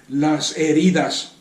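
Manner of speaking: slow, weighty las heridas, leaning on heridas; stress on heridas. Shown as a demonstration. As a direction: drag out heridas so it lands. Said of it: "estoy enfatizando la palabra heridas".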